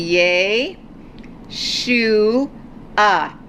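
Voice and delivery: yeh, shu and ah said one at a time; sounding out syllables. Shown as a demonstration. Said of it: "so it's going to be".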